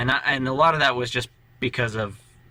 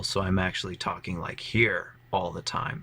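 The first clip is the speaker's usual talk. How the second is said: lower, softer character voice; toned down; 3 semitones lower, 4 dB quieter than usual. Shown as a demonstration. Player shows the new character's pitch, toned-down low voice. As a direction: drop the voice down low and ease off. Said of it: "toned his voice down a little".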